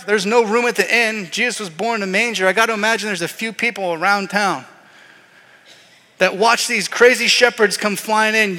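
normal talking voice

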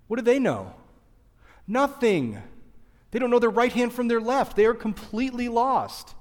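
rising emphasis. Real, not acted. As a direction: drive home that they are lost. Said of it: "sermon climax".

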